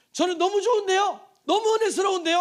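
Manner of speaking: high, loud insistent objection; gushing churchgoer. Acted. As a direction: play an enthusiastic believer insisting loudly. Acted churchgoer protesting how good it is. (높고 크게 우기는 성도 흉내)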